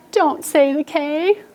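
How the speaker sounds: slow, high, pleading warning; worried ghost warning. Acted. Teacher as the worried ghost warning the class, high and slow. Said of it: "Do it just like he did".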